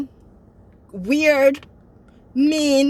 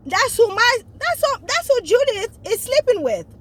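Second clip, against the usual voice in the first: high, louder stammered outburst; acted shocked realization; 10 semitones higher, 3 dB louder than usual. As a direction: blurt it high as it dawns on him. Acting out Bryce's shocked realization.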